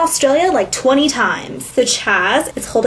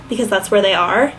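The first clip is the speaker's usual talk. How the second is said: ordinary talk, nothing marked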